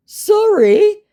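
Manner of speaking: high squeaky rising sorry; a baffled little yelp of sorry; confused scammer. Retold or imitated. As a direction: scammer caught off guard, very high pitched. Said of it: "came the rather confused response".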